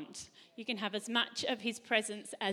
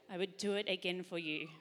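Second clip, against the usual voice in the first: much softer, gentle and low; a soft reassuring promise; tender Father; 4 semitones lower, 5 dB quieter than usual. Retold or imitated. Preacher voicing God's words to his child, tender and soft. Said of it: "he would say".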